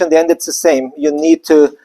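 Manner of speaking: ordinary delivery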